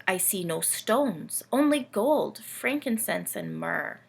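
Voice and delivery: put-on lively read-aloud for kids, camel telling its tale; storybook reading. A narrator's reading. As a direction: tell it like a bedtime picture book. Camel narrator reads story.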